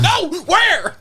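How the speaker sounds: high, fast panicked voice. Retold or imitated. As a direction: blurt it in a panic. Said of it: "he starts going, like, really quick".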